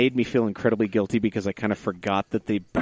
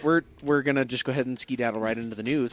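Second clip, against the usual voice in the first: a slow, upbeat merch ad read; sponsor plug; 4 semitones higher than usual. Acted. Hosts pitching their merch shop to listeners, upbeat ad read. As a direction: pitch the shop like a cheesy announcer.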